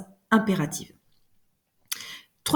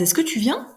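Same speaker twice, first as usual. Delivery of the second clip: Est-ce que tu viens? pitched higher, rising; rising question; 6 semitones higher than usual. Shown as a demonstration. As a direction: lift the voice on the question. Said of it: "on est plus aigu en haut".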